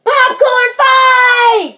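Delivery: high, loud, stretched battle cry; gleeful drawn-out holler. A narrator's reading. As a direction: launch the fight with a long shout. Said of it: "cried Froggy".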